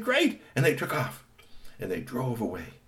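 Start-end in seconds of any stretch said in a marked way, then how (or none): 0.0-0.4 high excited yell; gleeful cheer. Acted. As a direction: celebrate the win. Villains cheer.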